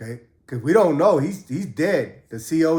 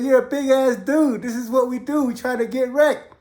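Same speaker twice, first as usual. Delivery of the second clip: incredulous; 8 semitones higher than usual. Retold or imitated. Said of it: "I was like".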